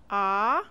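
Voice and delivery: "A" rising upward; second tone. Shown as a demonstration. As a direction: lift "A" up. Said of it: "say each tone after me".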